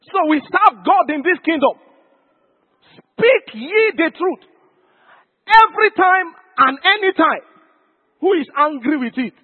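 emphatic preacher's exhortation; full-force preaching. Real, not acted. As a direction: stretch the command out and ring it over the congregation.

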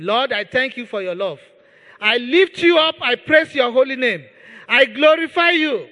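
loud, driving prayer at full force; fervent preaching. Acted. Preacher leading church in thanks to God, fervent full-voiced prayer. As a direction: pour it out over the congregation.

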